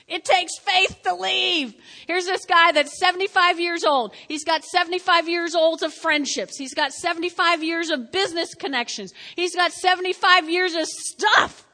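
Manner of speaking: loud, rising sermon build; full-force preaching. Acted.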